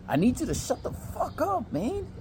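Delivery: high, strained but contained plea; acted exasperation. Man hushing his date near his parents' room, acted exasperation. Acting annoyance while parents sleep nearby.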